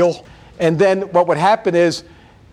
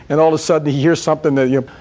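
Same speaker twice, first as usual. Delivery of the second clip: ordinary delivery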